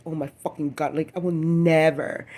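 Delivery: Oh my fucking God belted out; loud exasperation. Retold or imitated. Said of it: "I was like".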